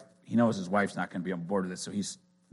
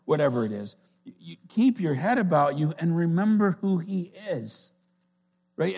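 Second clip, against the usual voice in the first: higher, louder, fervent preaching; 9 semitones higher, 5 dB louder than usual. Acted. Pastor urging the church to keep calm, preaching hard. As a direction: drive the point home from the pulpit.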